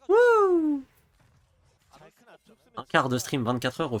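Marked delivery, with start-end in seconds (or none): 0.1-0.8 high, loud whoop with a laugh; excited whoop. Real, not acted. Hype shout on stream.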